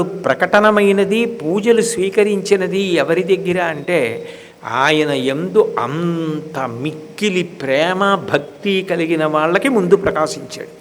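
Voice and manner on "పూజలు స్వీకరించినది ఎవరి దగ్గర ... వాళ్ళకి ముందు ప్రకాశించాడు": usual speaking voice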